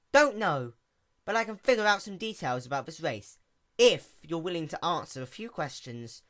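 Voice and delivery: quick, bright-eyed little mechanic pony; lightly voiced sea pony. A narrator's reading.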